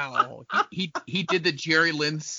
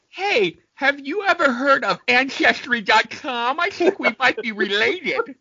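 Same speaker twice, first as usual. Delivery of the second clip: higher, slow, overly friendly fake greeting; mock friendly greeting; 9 semitones higher, slower than usual. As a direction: sweetly tease him like a long-lost cousin. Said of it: "you could have walked up to him and went".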